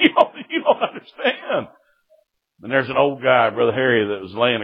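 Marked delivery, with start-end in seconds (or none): 0.0-1.7 very high, stammered comic pleading; mock panic. Jailed son begging the sheriff to understand, mock panic. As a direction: stumble into a hammed-up panicked squeal.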